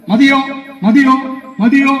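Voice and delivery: quick high repeated offers, each rising; mock server. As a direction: mimic the eager server asking is it enough. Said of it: "വിളമ്പണേന്റെ മുന്നേ തന്നെ തുടങ്ങും". (വേഗത്തിൽ ഉയർന്ന സ്വരത്തിൽ മതിയോ എന്ന് ചോദിക്കുന്ന വിളമ്പുകാരൻ)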